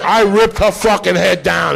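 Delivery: ordinary talk, nothing marked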